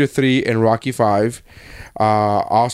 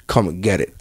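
low, understated tough-guy challenge; Rocky; 8 semitones lower than usual. Retold or imitated. Fan quoting the boxer answering his challenger outside, low dare. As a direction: imitate a boxer's calm dare, dropped low. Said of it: "And then Rocky goes".

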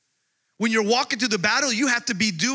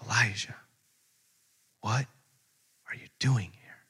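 whispered, low and soft; still small voice; 8 semitones lower, 6 dB quieter than usual. Retold or imitated. Preacher voicing God's still small voice, in a whisper. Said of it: "a still small voice".

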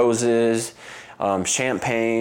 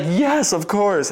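high, joyful yes through tears; imitating her happy high acceptance; excited yes; 10 semitones higher, 4 dB louder, slower than usual. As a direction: gush out the yes. Fiance accepting proposal.

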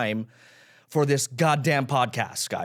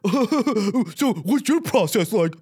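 mock interviewer voice; 5 semitones higher, 3 dB louder, much slower than usual. Mocks himself interviewing comics.

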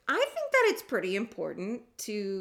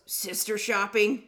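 drawn-out, louder imitation of a stranger; imitated man's voice; 3 semitones higher, 6 dB louder, slower than usual. Stranger in line repeats his question, imitated man's voice. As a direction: repeat it slowly as the man asking. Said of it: "And he goes".

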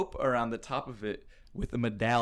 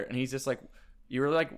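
ordinary talk, nothing marked

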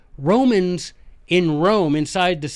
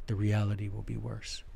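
ordinary talk, nothing marked

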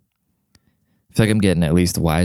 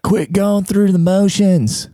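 mock coach; 11 semitones higher, slower than usual. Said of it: "the coach would be like".

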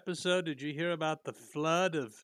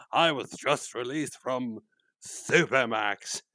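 Boris Karloff voice; 2 semitones lower, 5 dB louder, slower than usual. Retold or imitated. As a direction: drag out each word like a creepy old movie monster. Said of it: "my Boris Karloff impression".

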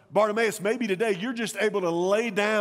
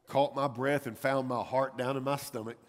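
ordinary talk, nothing marked

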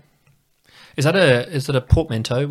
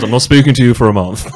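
louder, put-on offended colleague voice; haughty snub; 9 dB louder than usual. Teacher retelling colleague's sulk, in a louder mock-haughty voice. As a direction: ham up the colleague's indignant snub. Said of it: "He goes".

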